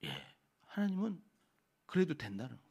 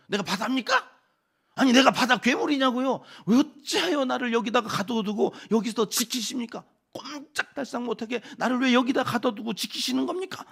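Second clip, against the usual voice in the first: loud, high-pitched furious protest; acted-out outburst of angry questions; 6 semitones higher, 8 dB louder than usual. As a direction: rail at God, nearly shouting. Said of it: "화를 내요".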